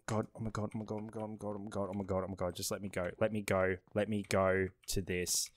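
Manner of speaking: low, hushed stream of repeated pleas; panicked muttering. Real, not acted. Player fleeing the killer, quietly panicked and pleading. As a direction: mutter it under your breath in a panic, without letting up.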